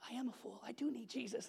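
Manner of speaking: a quiet imagined confession; hushed imagined confession. Retold or imitated. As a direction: murmur it like a convert. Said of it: "someone would be like".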